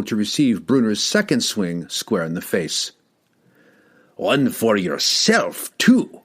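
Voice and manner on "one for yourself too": enraged dwarf punching a man, growling. A narrator's reading. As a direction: growl it, then explode on too. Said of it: "the enraged dwarf growled".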